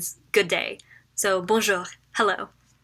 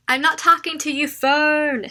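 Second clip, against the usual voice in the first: mock scolding; 6 semitones higher, 4 dB louder than usual. Real, not acted. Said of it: "scolding her phone".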